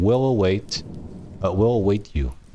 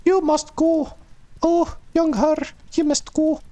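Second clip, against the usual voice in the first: put-on very high falsetto old woman, with a Scottish lilt; hysterical old lady. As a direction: plead in a shrill, put-on woman's voice. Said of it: "said in a very hysterical way".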